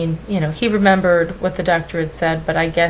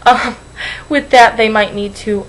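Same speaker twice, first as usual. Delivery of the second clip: choked up; 4 semitones higher, 7 dB louder, slower than usual. Real, not acted. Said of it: "getting all squishy".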